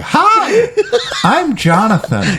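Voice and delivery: put-on very high, drawn-out kid greeting; high-pitched mock little boy; loser eight-year-old. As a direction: greet them like an overgrown, eager child. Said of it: "It's me as a fucking loser eight-year-old".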